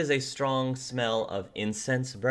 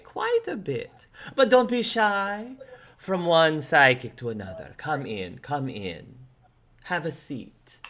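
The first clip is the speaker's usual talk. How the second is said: mysterious psychic; 5 semitones higher than usual. Acted. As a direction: purr it out like a grand old-style psychic. GM voices the psychic woman.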